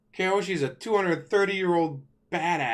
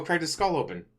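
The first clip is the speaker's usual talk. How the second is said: ordinary talk, nothing marked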